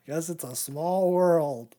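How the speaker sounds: slow, loud, put-on grand voice; hammed-up proclamation, slow and loud; mock grand. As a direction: boom it slowly like a big pronouncement. Mock-grand remark after a laugh.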